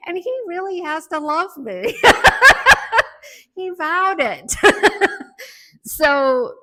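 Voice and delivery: laughing loudly through a joke; real bursts of laughter between words. Real, not acted. Joking to the audience about her husband, laughing.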